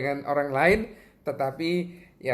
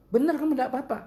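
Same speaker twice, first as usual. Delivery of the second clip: much higher pitched caring question; concerned double-check; 14 semitones higher, much faster than usual. Retold or imitated. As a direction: check kindly with the voice pitched right up. Acts out double-checking the young man.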